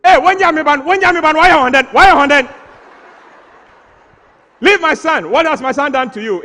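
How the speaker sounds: high, loud, shrieking mother yelling; mother's furious shout. Retold or imitated. Mother bursting into the ring, shouting at her son's opponent. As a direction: scream at the boxer to leave your son alone. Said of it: "And the voice went".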